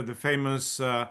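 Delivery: ordinary delivery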